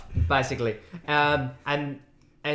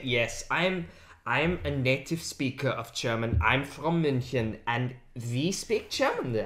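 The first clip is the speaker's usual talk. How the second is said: lower, softer mock German, slowed on München; lightly put-on German boast; mock German accent; 4 semitones lower, 7 dB quieter than usual. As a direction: parody of a German native speaker. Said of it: "when you say".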